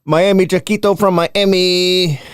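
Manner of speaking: high, loud hammed-up Spanish accent; over-the-top Cheech-style Spanish accent; horrible Spanish accent. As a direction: butcher the name in a cartoonish Spanish accent. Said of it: "Please say my name in a horrible Spanish accent".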